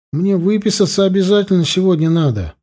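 put-on high-pitched, insistent teen pleading; mildly hammed-up urgent pleading; emotional teenager. A narrator's reading. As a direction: lightly play an agitated adolescent. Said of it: "изображая эмоционального подростка".

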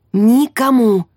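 НИКОМУ hit loud and drawn out; emphatic repeated order. A narrator's reading. Leader warning the squad to keep to the plan, loud emphatic НИКОМУ. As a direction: punch "Никому" loudly as a lone word.

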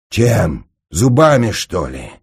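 loud, hoarse, effortful retort; strained wheeze. A narrator's reading. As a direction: wheeze it while straining to hold someone. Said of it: "просипел побагровевший от натуги будушник". (сипло, с натугой)

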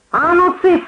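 high-pitched scolding command; sharp shout. A narrator's reading. Giantess silencing the drunkard, shouting at him. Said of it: "прикрикнула великанша".

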